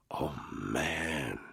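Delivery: breathing out in horror at the blog post; breathed. Acted. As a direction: let it out on a breath, shaken. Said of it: "he breathed".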